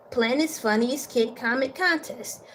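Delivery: plain reading voice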